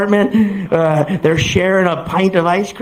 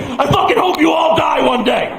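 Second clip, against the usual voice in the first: bitter roommate curse; 7 semitones higher than usual. Acted. Roommate curses the laughing friends.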